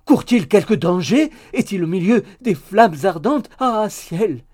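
loud, high theatrical panic; melodramatic alarm. A narrator's reading. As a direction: wail it out in mock terror. Maid feigns alarm at danger. (affolement théâtral, fort et aigu)

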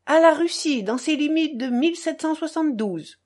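shrill formal toast; high-pitched. A narrator's reading. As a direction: pitch it high and hold it there. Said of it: "dit d'une voix aiguë".

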